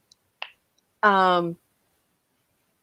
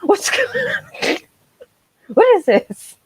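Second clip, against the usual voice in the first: squeaky high disbelief, laughing at the end; baffled outburst; 14 semitones higher than usual. Retold or imitated. Fan recalling her confusion at the twist, squeaky and laughing. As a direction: voice shooting way up in mock outrage. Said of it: "I was like".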